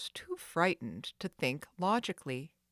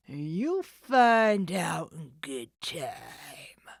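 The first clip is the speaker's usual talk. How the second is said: very slow breathy rasping menace; sinister taunt; 6 dB louder, much slower than usual. Acted. The thief taunts Nancy, slow sinister rasp. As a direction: draw it out cruelly. Villain gloating.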